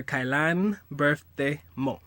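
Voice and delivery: Kailan birthday mo drawn out slowly; slow model phrase. Shown as a demonstration. Teaching how to ask her birthday, slow and spaced out. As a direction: say Kailan birthday mo slowly, with gaps between words.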